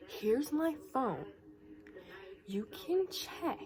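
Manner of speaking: slow it right down and push each word at her; emphatic retort. Retold or imitated. Offering phone to her accuser as proof, emphatic retort. Acted retort in a retold quarrel.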